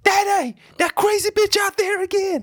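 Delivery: high, loud mock alarm call; hammed-up shout. Host re-enacting a comedy film scene, high mock shout. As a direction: yell it out like a movie character. Acting out a Friday movie line.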